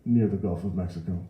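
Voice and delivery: ordinary delivery, nothing marked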